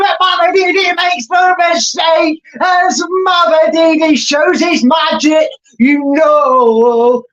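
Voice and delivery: rising to a high, loud goal call, stretched out at the end; excited goal shout. Real, not acted. Fan commentator erupting as Leicester score, high and loud. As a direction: scream the goal as he breaks through.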